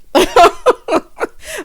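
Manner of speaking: loud, high burst of laughter; amused, high-pitched laughing fit; high cackle. Real, not acted. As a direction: cackle loudly at your own joke. Laughs at Chris being relaxed.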